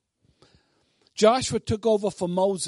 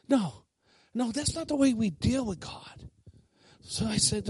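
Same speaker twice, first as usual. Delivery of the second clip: normal talking voice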